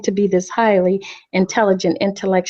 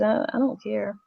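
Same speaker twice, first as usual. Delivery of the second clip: ordinary talk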